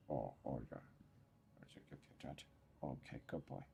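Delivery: soft whispered petting talk; whispered cooing. Real, not acted. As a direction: hush it down to a whisper for the cat. Petting the cat quietly.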